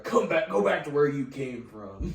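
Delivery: loud hostile yell; angry local. Retold or imitated. Crazy locals yelling.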